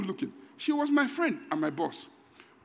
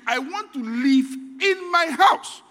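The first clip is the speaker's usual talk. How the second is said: slow, firm declaration of the prayer; slow, measured proclamation; declared prayer; 5 semitones higher, 5 dB louder, slower than usual. Shown as a demonstration. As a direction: say it slowly like a settled decision. Model prayer point, declared.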